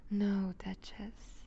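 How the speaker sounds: slow whispered title; showing the pet how to answer. Acted. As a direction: breathe the title out slowly. Teaching captive the reply.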